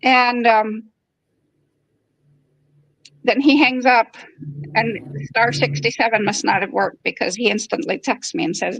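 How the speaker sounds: ordinary talk, nothing marked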